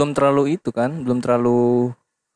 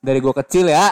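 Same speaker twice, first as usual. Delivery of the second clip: pointedly louder on request; raised voice, pushed at the end; speaking up; 3 dB louder than usual. Real, not acted. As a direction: project it after being told to speak up. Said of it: "yang kenceng".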